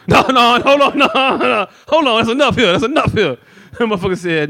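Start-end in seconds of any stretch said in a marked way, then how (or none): 0.1-3.4 high, slowed, laughing plea; mock frantic old man. Old pop running out to stop the fight, high laughing plea. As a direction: beg them to stop.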